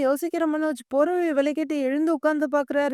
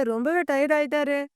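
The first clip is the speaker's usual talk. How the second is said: ordinary delivery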